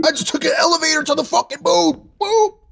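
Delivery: very high excited squeal; high cartoon voice. Quoting SpongeBob game line.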